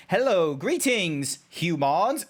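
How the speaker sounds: slow, stilted greeting with swooping pitch; drawn-out, mock-formal furry greeting; random furry. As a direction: greet them like an odd, stilted creature. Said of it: "a random furry is just like".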